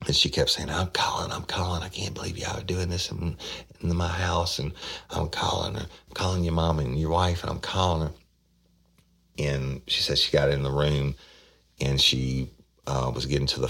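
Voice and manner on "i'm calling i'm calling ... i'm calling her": higher-pitched mock outrage without volume; retold hollering. Grandmother threatening to call mom, mock hollering. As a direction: run the threats together as an imitated tirade. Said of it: "she started screaming and hollering".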